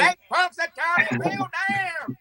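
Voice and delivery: slow, high, put-on voice; squeaky fake command; high-pitched mock order. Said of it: "somebody needs to go out and be like".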